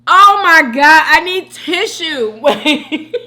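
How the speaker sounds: loud outburst breaking into laughter; laughing to tears. Real, not acted. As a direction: cry out, helpless with laughter. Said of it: "I am literally shedding tears".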